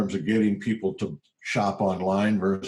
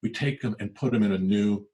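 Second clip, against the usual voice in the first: ordinary talk, nothing marked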